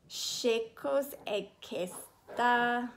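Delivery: slow Italian "Che cos è questa?". Acted. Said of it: "she said in Italian".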